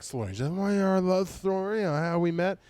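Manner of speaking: high, slurred mock drunk woman; slurring drunk heckler. Drunk woman offering her love story, slurred insistence. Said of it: "I'm saying that she's like".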